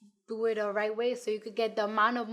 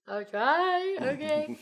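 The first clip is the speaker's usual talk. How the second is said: high, drawn-out reluctant okay; hesitant agreement; 5 semitones higher, 3 dB louder, slower than usual. Retold or imitated. Artist retelling her answer to her manager, hesitant. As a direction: agree with comic reluctance, voice going up.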